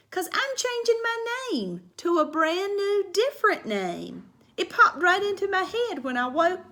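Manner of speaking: put-on high, bouncy little girl voice; lively kindergartner telling her story; Junie B. voice. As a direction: read it as an eager little girl. Reads Junie B. voice.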